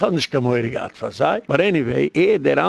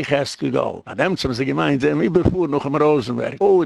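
ordinary talk

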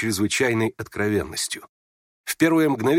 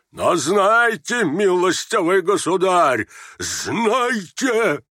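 slow, high-pitched offended tirade; indignant outburst; 7 semitones higher, 3 dB louder, much slower than usual. Acted. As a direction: draw out each word, building to high outrage. Offended general announces he is leaving. (возмущённо, протяжно, всё выше)

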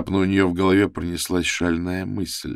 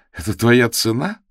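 confused surprise; 6 semitones higher, 6 dB louder than usual. A narrator's reading. Confused Lana asks price.

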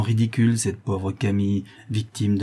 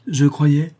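nasty and cutting, higher and louder; spiteful; 6 semitones higher, 5 dB louder than usual. A narrator's reading. Camille striking back at Claire, spiteful. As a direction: spit it out with malice. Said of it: "reprit méchamment Camille".

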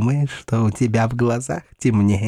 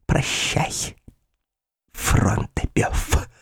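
slow menacing whisper; sinister whisper; 4 semitones higher, 4 dB quieter, much slower than usual. Acted. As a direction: whisper the farewell slowly. Ulrica's sinister farewell.

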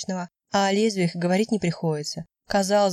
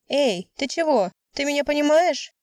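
mildly raised, higher-pitched insistent questioning; 7 semitones higher than usual. A narrator's reading. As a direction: push the question as if to get through to someone. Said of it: "повышая голос".

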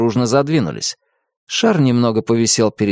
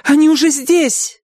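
panicked queen; 4 dB louder than usual. A narrator's reading.

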